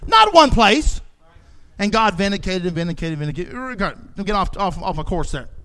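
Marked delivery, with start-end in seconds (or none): 1.8-5.5 much softer and lower, muttered to himself; preacher correcting himself off topic, soft low aside; quiet aside. Real, not acted.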